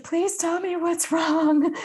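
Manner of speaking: very high, drawn-out pleading; acted mom panic. Mother begging her crying daughter for answers, high panicked plea. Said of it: "so even more panic".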